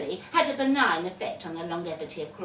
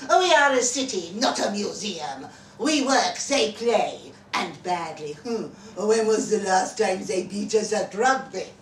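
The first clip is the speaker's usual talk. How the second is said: louder, swaggering townsman voice; proud local boaster; 7 dB louder than usual. Acted. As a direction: sneer at the rival city with pride. Rival city citizen boasting.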